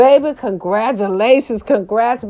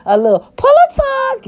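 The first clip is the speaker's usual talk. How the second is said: squeaky high-pitched catchphrase; high squeaky singsong; 4 dB louder than usual. Acted.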